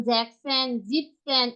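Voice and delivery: জ্যাকসন as "zek-sen", জিপসেন as "zip-sen"; merged compound forms. Shown as a demonstration. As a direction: run each shortened stem straight into "sen" in জ্যাকসন, জিপসেন. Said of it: "মিলিত করে".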